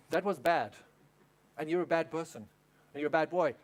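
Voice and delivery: low, stern reprimand, phrase by phrase; scolding bad mom. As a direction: imitate a parent coldly shaming a child. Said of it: "You know what bad mom does?".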